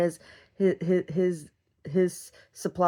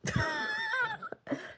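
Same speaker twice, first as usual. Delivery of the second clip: squeaky high laugh; 11 dB quieter than usual. Real, not acted. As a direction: burst into a giggle. Laughs at own joke.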